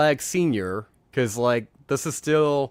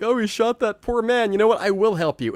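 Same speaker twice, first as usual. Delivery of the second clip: higher-pitched mock distress; imitated crying; 8 semitones higher than usual. Podcaster retelling a character's sudden tears, mildly mock-weepy. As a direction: lightly act out a tearful change of heart. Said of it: "he starts crying".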